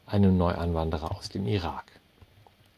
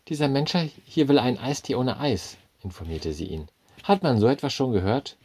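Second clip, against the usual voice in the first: high, louder indignant report; indignant waitress; 7 semitones higher, 5 dB louder than usual. A narrator's reading. Waitress complains to the manager, high and scandalised. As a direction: sound scandalised.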